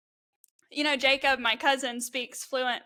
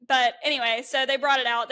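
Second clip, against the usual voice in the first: ordinary talk, nothing marked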